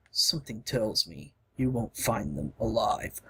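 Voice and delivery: deep hero voice. Acted. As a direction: speak as a big, steady warrior. Gar's grim remark.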